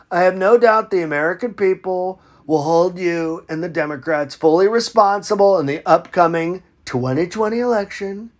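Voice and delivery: normal talking voice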